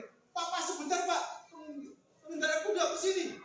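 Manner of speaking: high, urgent hail; acted shout for attention; calling out. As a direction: call a passer-by to stop. Two quarrellers flag down rider.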